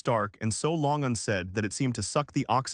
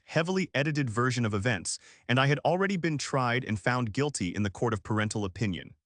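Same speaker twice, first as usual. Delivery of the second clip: plain reading voice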